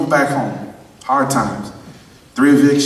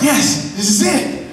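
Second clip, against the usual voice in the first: high, laughing yes; overjoyed, laughing; excited, laughing; 8 semitones higher, slower than usual. Retold or imitated. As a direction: celebrate the big news with a laugh. Excited self-talk about the move.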